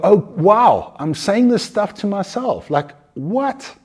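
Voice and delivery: high-pitched, dawning surprise; acted astonished realization. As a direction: suddenly catch yourself in mock disbelief. Acted sudden realization of self-talk.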